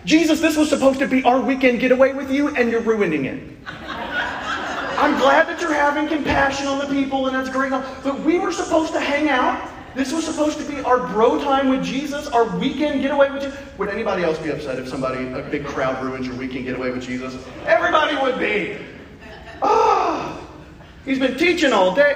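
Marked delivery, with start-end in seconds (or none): 0.1-13.5 high whiny complaint to Jesus; mock whining disciples. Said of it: "Let me translate that for you". 19.6-22.1 mock complaint. Disciples complaining about Jesus.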